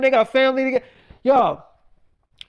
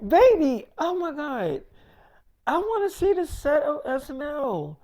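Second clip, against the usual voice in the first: put-on higher, softer girlish plea, whining a little; pleading girly voice; 5 semitones higher, 3 dB quieter than usual. Friend Jasmine begs David to see the set, high pleading girly voice. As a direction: beg like an excited young woman wanting to tag along.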